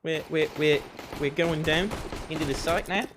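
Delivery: high-pitched New Zealand accent; Taika Waititi. Shown as a demonstration. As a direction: put on a shy-sounding Kiwi voice. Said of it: "I just do a Taika Waititi impression if I'm trying to do Kiwi".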